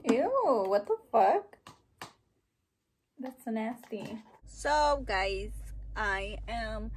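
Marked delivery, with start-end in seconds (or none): 0.1-1.6 louder, rising "what the fuck?" in real disgust; disgusted surprise. Real, not acted. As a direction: recoil and say "what the fuck?" with the pitch rising at the end. Reacting to something nasty.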